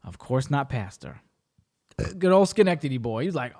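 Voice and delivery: ordinary talk, nothing marked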